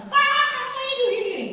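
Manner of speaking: put-on high, loud childish squabble; mock a sibling fight; bickering. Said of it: "anak-anak suka berantem". (pertengkaran anak yang melengking)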